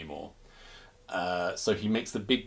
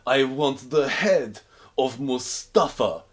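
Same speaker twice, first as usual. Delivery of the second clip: slow, louder Russian demand; mock Russian accent; 4 semitones higher, 5 dB louder, much slower than usual. Pushkin making his wish, slow mock Russian. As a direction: spell out the wish with menace.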